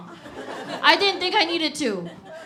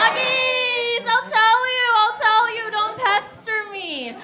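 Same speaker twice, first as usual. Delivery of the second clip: mock coy teasing; 8 semitones higher, slower than usual. Teasing the crowd, higher.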